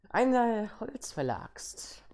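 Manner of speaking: slow giggly whisper. Real, not acted. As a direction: whisper it like a sneaky thief. Sneaking, stealing the old man's axe. (geflüstert mit Kichern)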